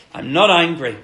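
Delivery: a strong, reassuring declaration; emphatic insistence. Retold or imitated. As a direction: insist he isn't angry. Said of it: "he's like".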